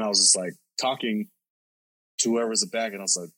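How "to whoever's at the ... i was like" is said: ordinary delivery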